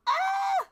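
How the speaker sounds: sharp gasp then a high loud shriek; a startled squeal of panic; shocked gasp and shriek. Real, not acted. Realises she forgot the link.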